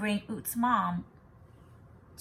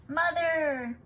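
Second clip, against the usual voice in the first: joyful cry; 7 semitones higher, 4 dB louder than usual. A narrator's reading. Joyful reunion at window.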